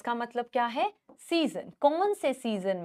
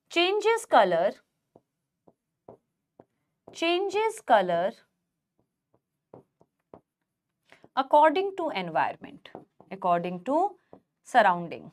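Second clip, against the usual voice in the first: word by word, slowly, for students to write; slow dictation; much slower than usual. Acted. Teacher dictating notes to her students, slow and spaced. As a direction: spell it out slowly so the class can copy.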